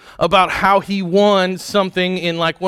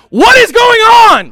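high, loud, slow cry of disbelief; frustrated shout; 10 dB louder than usual. Retold or imitated. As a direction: yell it like the kid picked last. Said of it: "he's like".

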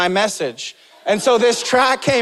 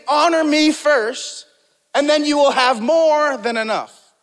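higher, louder voice of God; preacher lifting his voice as God; voicing God; 6 semitones higher, 3 dB louder than usual. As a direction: speak God's promise with authority. Preacher voicing God's words.

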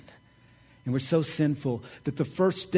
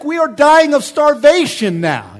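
higher, louder, hammed-up starving wail; exaggerated complaint; 12 semitones higher, 12 dB louder than usual. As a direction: overact the hungry wife's protest. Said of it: "She's like".

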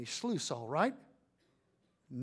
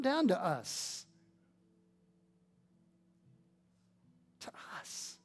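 prayer. Acted. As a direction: pray humbly before the church. Leads a closing prayer.

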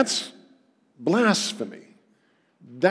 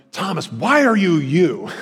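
mock scolding; 4 semitones higher, 4 dB louder than usual. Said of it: "He's not correcting him and like".

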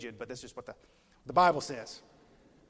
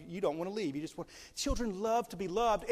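ordinary delivery

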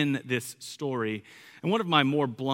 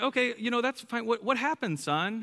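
reassuring dad; 10 semitones higher than usual. Retold or imitated. Dad reassuring child in retold talk.

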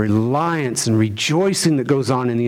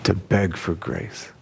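soft echo; 5 semitones lower, 5 dB quieter than usual. Acted. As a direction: repeat it softer, slow and lower. Preacher echoes the phrase softly.

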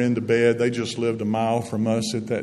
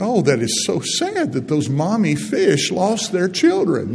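put-on a higher, sad little-girl voice, lightly done; sad little granddaughter; 7 semitones higher, 4 dB louder than usual. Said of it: "she's got all sad".

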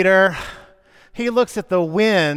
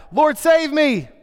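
a much higher desperate cry for help; 11 semitones higher, 5 dB louder, slower than usual. A narrator's reading. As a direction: call out as if sinking. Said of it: "cried out".

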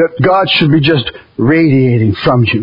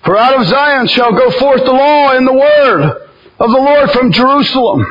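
raised, solemn preaching read; scripture reading; 6 semitones higher than usual. Acted. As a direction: proclaim the verse from the pulpit. Reads scripture aloud.

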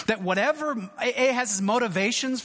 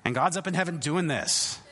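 ordinary talk

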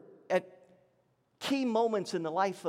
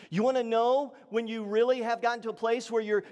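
ordinary talk, nothing marked

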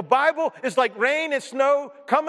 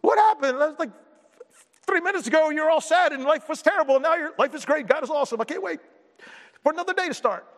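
much higher, excited and amazed; hammed-up joyful amazement at a changed mood; giddy self-mockery; 7 semitones higher than usual. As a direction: bubble over like you can't believe it. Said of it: "[name] schizophrenic moments".

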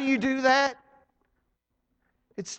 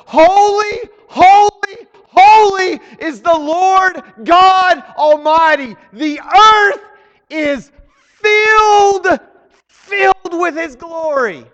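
loud, high cry of holy, holy, holy; proclaiming shout; 6 semitones higher, 9 dB louder, slower than usual. Acted. Pastor booms the seraphim's cry to the congregation, loud and high. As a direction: shout it out like angels calling.

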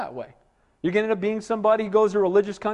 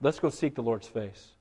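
lower, softer invitation; gentle; 8 semitones lower, 8 dB quieter than usual. Retold or imitated. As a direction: invite a brother quietly. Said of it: "puts his arm around him".

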